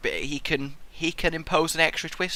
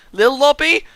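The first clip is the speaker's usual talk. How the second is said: high, loud questioning call; 8 semitones higher, 7 dB louder than usual. Real, not acted. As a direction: shout the name across the map. Calling to other player.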